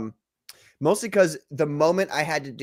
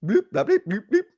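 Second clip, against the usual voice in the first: bubbling noises; 6 semitones higher, 3 dB louder than usual. Acted. Imitating bubbling.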